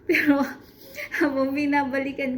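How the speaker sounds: loud high burst of laughter into the word; laughing. Real, not acted. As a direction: laugh it out before speaking. Laughing at own old vlogs.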